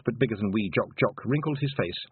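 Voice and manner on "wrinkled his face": plain reading voice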